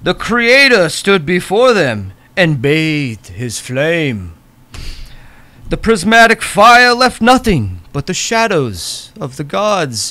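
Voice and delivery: slow, grand storyteller voice; epic narration. Acted. The game master recites the legend to the players, grandly. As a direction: intone it like an ancient legend.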